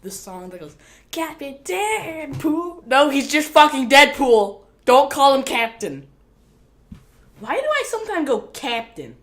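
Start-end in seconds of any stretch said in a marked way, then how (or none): none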